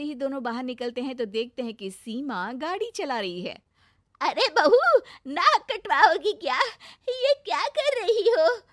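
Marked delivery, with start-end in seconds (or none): 4.2-8.6 loud, high outraged yell; scolding shout. Acted. Mother-in-law catches the bride riding, loud high scolding. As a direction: shriek at her like the family's shamed.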